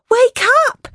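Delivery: high-pitched urgent shout. A narrator's reading. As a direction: cry out to wake someone in alarm. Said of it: "I shouted to my mother".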